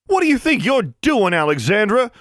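high, loud outraged yell; startled angry shout. Acted. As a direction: shout at the one who hexed her. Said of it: "she shouted".

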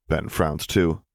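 usual speaking voice

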